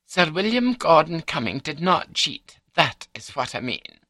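high, long-suffering wife's voice; patient wife. A narrator's reading. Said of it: "She sighed in a patient Griselda sort of way".

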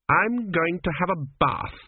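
put-on a young queen putting her foot down; queen's decision. Queen cutting off the priest's list, firm and slow.

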